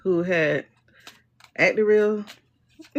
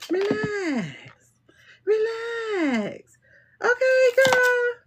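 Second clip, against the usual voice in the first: high cooing relax; 14 semitones higher, much slower than usual. Acted. Reader cooing at anxious viewer, high and drawn out.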